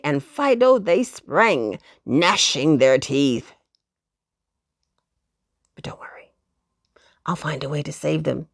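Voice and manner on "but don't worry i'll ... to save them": whispered promise; soft reassurance. Acted. Narrator comforting listeners after the cliffhanger, whispered.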